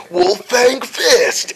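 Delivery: drawn-out, high anime attack call; Yamcha. Retold or imitated. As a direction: imitate an anime fighter calling the move. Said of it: "in Yamcha's voice goes".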